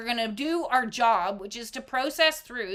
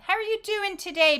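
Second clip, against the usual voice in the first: cheery friend; 9 semitones higher, faster than usual. Acted. Acted check-in between two people.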